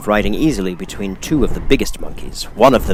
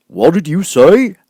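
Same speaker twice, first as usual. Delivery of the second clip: louder, higher, slow puzzled question; puzzled scarecrow; 10 semitones higher, 8 dB louder, slower than usual. A narrator's reading. Scarecrow puzzled by the girl, slow loud question. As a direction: ask it baffled, as the straw man.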